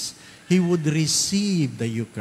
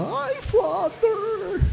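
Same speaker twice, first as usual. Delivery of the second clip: very high, drawn-out Ay Father; excited greeting; much slower than usual. Retold or imitated. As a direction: call out delightedly to the priest. Parishioner calling out to priest. (masiglang tawag sa pari)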